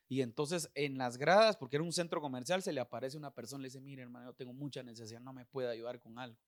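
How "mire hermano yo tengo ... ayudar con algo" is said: very quiet, low mumbled request; hushed plea. Retold or imitated. Needy stranger asking a man for help, hushed plea. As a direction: imitate a timid beggar, low and under the voice.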